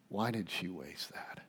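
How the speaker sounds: low voiced grumble, still quiet; quiet disapproving murmur; soft murmur. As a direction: mutter it under the breath with voice. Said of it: "they murmur to one another".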